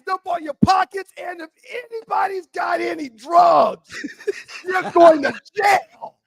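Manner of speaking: loud, high, drawn-out shouted commands; barking cop order. Retold or imitated. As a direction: order the whole group around like an outraged cop. Cop ordering everybody off bikes.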